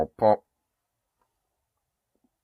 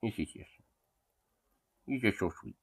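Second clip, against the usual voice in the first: soft pet talk; 9 dB quieter than usual. Real, not acted. Owner murmuring to her big dog, hushed and tender.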